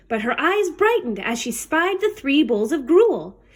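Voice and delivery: plain reading voice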